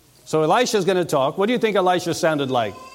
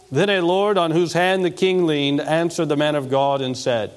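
ordinary talk, nothing marked

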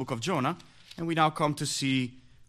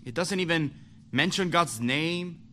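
preaching; 4 semitones higher than usual. Acted. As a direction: preach it out with fire. Preaching at full force.

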